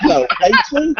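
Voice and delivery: put-on playful British-accent greeting. Showing friends how she greets Peachy, British accent. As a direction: try a British hello, amused. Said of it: "do it with a British accent".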